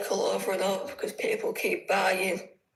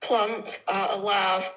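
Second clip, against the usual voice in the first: slow, each word set apart; slow and careful; slower than usual. Shown as a demonstration. Asked to repeat for the host, said slowly and carefully. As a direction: spell it out slowly so she can follow. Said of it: "just say a few words and stop".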